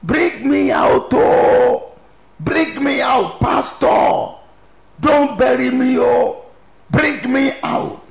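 high, drawn-out mock scream for help; imitated screaming. Preacher mimics woman being buried begging pastor, imitated screaming. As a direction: cry out slowly and high, imitating panic. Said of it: "she screamed".

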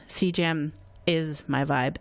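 ordinary talk

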